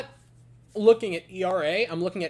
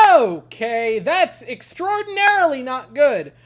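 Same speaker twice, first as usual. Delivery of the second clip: louder, slowed ironic understatement; mock dismay; 6 semitones higher, 11 dB louder, slower than usual. Fan watching the lead vanish, loud mock dismay. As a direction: drag out the disbelief. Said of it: "and you go".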